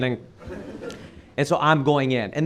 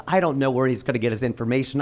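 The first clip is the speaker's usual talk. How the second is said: ordinary talk, nothing marked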